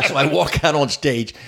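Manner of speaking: usual speaking voice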